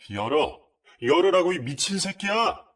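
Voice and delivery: furious shouting at the door; raging yell, pitch climbing high; cursing shout. Retold or imitated. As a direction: scream curses to break the door down. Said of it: "하면서 욕을 하더라고요".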